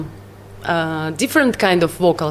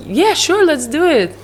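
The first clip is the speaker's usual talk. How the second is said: cheerful yes; 8 semitones higher than usual. Retold or imitated. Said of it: "And she said".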